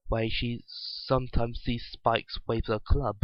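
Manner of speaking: ordinary delivery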